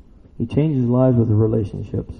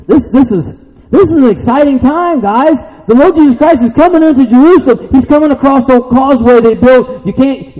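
loud, high-pitched pulpit excitement; excited preaching; 9 dB louder than usual. Real, not acted. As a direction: preach it at full force, high and urgent. Preaches at full force.

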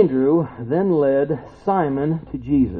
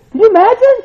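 much higher and louder, nearly shouting; full-force preaching; 14 semitones higher, 11 dB louder than usual. Acted. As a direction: preach with rising fire.

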